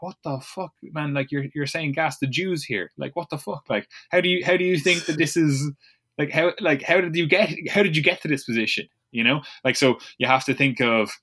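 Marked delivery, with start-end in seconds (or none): 0.0-8.9 half-spoken disbelief, pitched high; incredulous outsider. Retold or imitated. Outsider to the extremist, disbelieving. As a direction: ask how they got there in disbelief.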